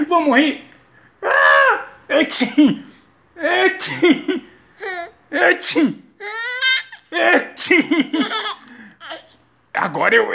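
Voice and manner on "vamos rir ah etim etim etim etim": high clown play voice. Acted. Clown dad making baby laugh.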